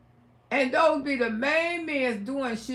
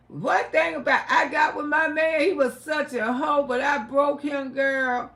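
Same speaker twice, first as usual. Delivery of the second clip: ordinary talk, nothing marked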